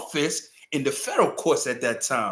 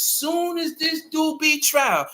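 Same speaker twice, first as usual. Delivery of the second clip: outraged shout; 11 semitones higher, slower than usual. Real, not acted. Outrage over trial attention.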